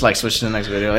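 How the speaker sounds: usual speaking voice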